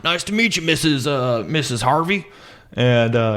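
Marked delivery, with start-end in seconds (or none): 0.0-2.3 lightly voiced higher greeting; a hint of a charming captain; Captain Ron greeting. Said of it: "he's like".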